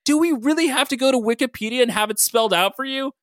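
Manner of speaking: high-pitched exasperated complaint. A narrator's reading. Todd loses patience with clueless Logan, exasperated. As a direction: spell out your exasperation. Todd's exasperated outburst continues.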